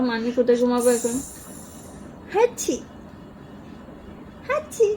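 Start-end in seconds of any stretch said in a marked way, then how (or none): 2.3-4.9 playful sneeze call. Pretend. Mother voicing baby's sneeze.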